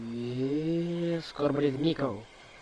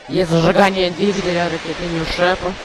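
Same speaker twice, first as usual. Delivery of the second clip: excited; 4 semitones higher, 6 dB louder than usual. Real, not acted. Ignition moment in live launch.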